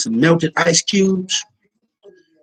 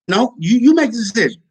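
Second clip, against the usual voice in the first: insistent raised voice; 6 semitones higher, 3 dB louder than usual. Acted. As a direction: push her to decide, louder and higher. Pressing Sally to decide.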